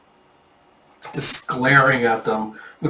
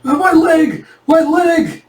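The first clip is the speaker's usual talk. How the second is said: high, loud shriek of pain; acted wounded-bandit wail; agonized screaming; 7 dB louder, slower than usual. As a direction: scream as if your leg is gone. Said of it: "the bandit's just screaming".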